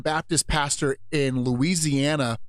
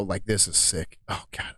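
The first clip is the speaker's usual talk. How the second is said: ordinary talk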